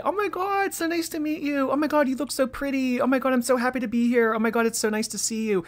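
high breathless gushing fan voice. Retold or imitated. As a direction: squeal it like a starstruck fan. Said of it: "anybody can meet someone like this and say".

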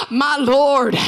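ordinary delivery

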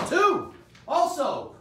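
high, loud excited yell; excited shout. Real, not acted. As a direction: burst out with excitement. Excited shout announcing two boxes.

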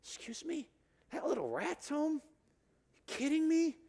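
put-on high, incredulous older brother; angry disbelief. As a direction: spit out the outrage as a scoff. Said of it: "the older brother gets angry".

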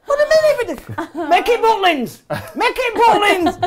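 high, laughing outburst at the script; acting out a horrified actor, laughing high; mock outrage. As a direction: squeal in comic disbelief.

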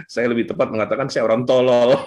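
self-mocking, breaking into laughter; laughing. Real, not acted. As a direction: say it through a laugh. Laughs while calling himself stupid. (sambil tertawa menertawakan diri sendiri)

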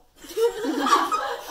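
loud, high acted awkward laugh; awkward chuckle. A narrator's reading. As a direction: laugh loudly to ease the tension. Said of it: "Louis chuckled awkwardly".

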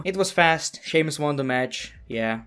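ordinary talk

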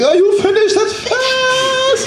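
high-pitched amazed shouting; hammed-up excited squeal; excited stranger. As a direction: burst out way up high, thrilled by the speed. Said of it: "he's so excited about how fast".

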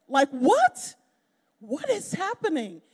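loud, high disbelief; what, in loud wonder; amazed. Real, not acted. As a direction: cry out in astonishment. Amazed at bronze medal.

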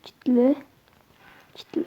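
soft whispered repeat. Real, not acted. Still checking the doors, whispering. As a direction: breathe the word out quietly.

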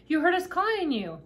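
high, loud, delighted-to-be-heard call; tiny-voiced shout. A narrator's reading. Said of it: "he shouted in his tiny voice".

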